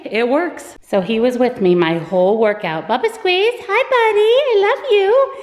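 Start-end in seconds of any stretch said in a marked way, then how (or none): 2.9-5.3 high, loud, cooing dog voice. Real, not acted. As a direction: gush over the dog. Talking to dog.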